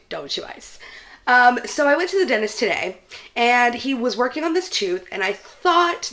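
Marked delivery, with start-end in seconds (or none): 0.1-0.8 soft whispered repeat of the warning; hushed aside to viewers. Real, not acted. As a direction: whisper it like a secret tip.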